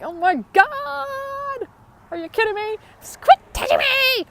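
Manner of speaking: high, loud, whiny exasperation; exasperated annoyed child. Retold or imitated. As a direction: squeal like a pestered kid. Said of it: "you're gonna be like".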